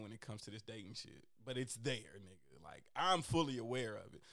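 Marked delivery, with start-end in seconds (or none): none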